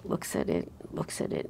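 whispered, trailing off as he stares; hushed repetition. Retold or imitated. Narrator whispering as the guard stares at the papers. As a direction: let the words fade to a whisper. Guard slowly scrutinizing the document.

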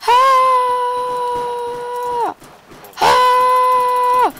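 long high panicked screams. Real, not acted. Player screaming while caught in gunfire, high and loud. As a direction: scream out in panic under fire.

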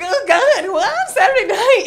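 put-on loud, high drunken exclaiming; acting drunk. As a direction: play a merry drunk brushing it off. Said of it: "I'm so drunk that I'm just like".

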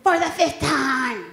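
mock exasperated mother repeating herself; Momzilla scolding. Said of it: "she's like".

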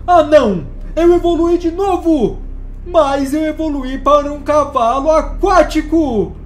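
high, loud, slow dismay; dismayed outcry. Real, not acted. Unwanted evolution.

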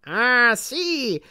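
put-on old witch exclaiming. A narrator's reading. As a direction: recognize him with grand approval. Grandmother recognizing Harry. (la nonna riconosce Harry, voce acuta)

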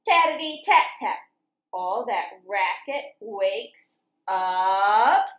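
lively sing-song picture book reading; reading to children. A narrator's reading. Teacher reading farm story to children, lively sing-song. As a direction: read it bouncy, like for little kids.

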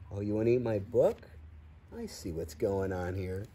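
plain reading voice

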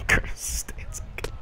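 slow, stretched-out name; drawn-out mocking. Real, not acted. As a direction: savor the name slowly.

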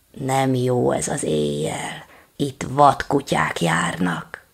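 slow, low breathless whisper; panting whisper. Acted. Big dog whispers the warning, slow and out of breath. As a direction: gasp it out, winded. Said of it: "lihegett, nyögött".

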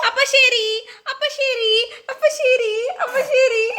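high sing-song repeated sign-off. Real, not acted. Mother playfully saying bye beside her baby, high sing-song.

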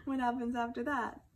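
usual speaking voice

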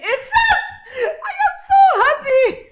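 high, loud joyful yells with laughter; ecstatic cheering. Acted. Wife shrieking with joy over news. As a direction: burst out with delight. Wife announcing France selection.

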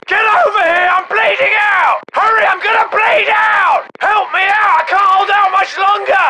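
wounded soldier yelling for help; high, frantic battlefield plea; desperate screaming. Acted. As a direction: scream as if bleeding out. Bleeding soldier begs for help.

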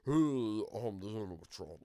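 slow, creepy robot monster; hammed-up creepy slow voice; creepy animatronic voice. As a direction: drag it out like a spooky animatronic. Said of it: "really creepy voice".

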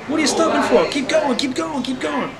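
high, loud shriek urging the driver on; mock-alarmed high yell; alarmed British family. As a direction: passengers yelling at the stopped cab. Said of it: "The British family in the back are like".

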